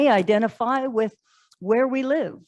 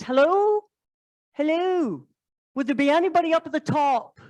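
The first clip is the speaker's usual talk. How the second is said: higher calling out into the fog; 6 semitones higher than usual. Acted. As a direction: call up hopefully, voice raised high. Climber calling out from rope.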